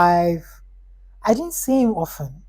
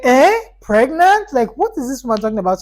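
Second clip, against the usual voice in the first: high, loud startled questioning, then rushed; acted-out startled indignation, pitched up; baffled shock; 6 semitones higher, 6 dB louder, faster than usual. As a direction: react in disbelief to an absurd accusation. Reacting to mother's pregnancy warning.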